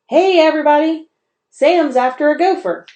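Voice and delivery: put-on high, loud excited boy's yell. A narrator's reading. Camp boy yelling to everyone about the chase. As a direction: call everyone over at the top of your voice. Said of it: "a boy yelled".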